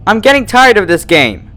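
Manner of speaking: high, loud, fed-up snap; angry snap. A narrator's reading. Twilight snaps at the hidden voice, angry. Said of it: "Twilight snapped".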